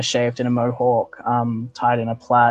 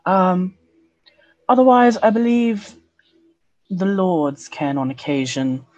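higher, sultry elf woman; femme fatale; 8 semitones higher than usual. Acted. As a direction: purr it like a seductress. GM voices elven woman NPC.

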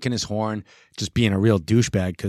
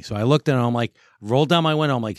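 ordinary talk

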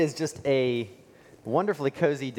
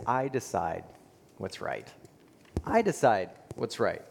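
low, firm, deliberate declaration; self-assured creed; 4 semitones lower, 4 dB quieter than usual. Pretend. Preacher voicing a self-reliant person's creed, quiet and firm. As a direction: voice the culture's proud claim, low and sure.

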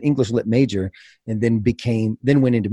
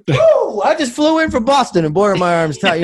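hammed-up stand-up comic, much higher and louder, laughing; cheesy comedian; 10 semitones higher, 4 dB louder than usual. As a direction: ham it up like a corny club comic opening his set. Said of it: "freaking cheesed it up".

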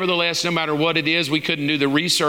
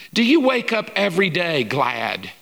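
ordinary talk

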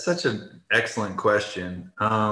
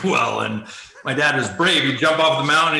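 usual speaking voice

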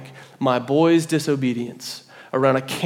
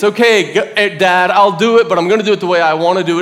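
raised, insistent grudging compliance; reluctant obedience; 4 semitones higher, 5 dB louder than usual. Retold or imitated. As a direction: give in loudly but defiantly. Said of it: "It's like this reluctant obedience".

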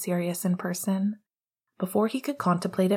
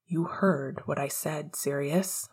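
hollow and unkind; 3 semitones lower, 3 dB quieter than usual. Acted. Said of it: "an edge in his voice, hollow and unkind".

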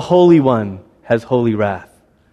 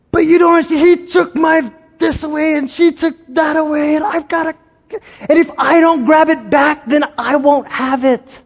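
put-on high, whiny pleading kid voice; whiny child; 13 semitones higher than usual. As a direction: protest that everything was taken. Child protesting to God.

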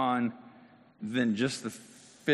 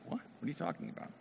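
confused surprise; 5 semitones higher, 12 dB quieter, faster than usual. Acted. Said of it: "who knows what he was feeling, like".

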